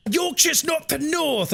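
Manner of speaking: high, slow, put-on northern protest; mock outraged Yorkshire viewer; indignant northerner. Chat viewer protesting Yorkshire isn't north, in an indignant put-on voice. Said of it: "they'll be like".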